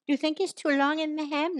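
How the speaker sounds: a hint of an imitated wife; wife asking. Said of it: "Now listen to this, girls, this'll ring a bell".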